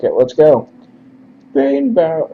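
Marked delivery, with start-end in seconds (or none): 1.5-2.3 narration slipping into falsetto; high-pitched character voice. Acted. Reader slips into messenger's high-pitched voice. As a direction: start high, then break off.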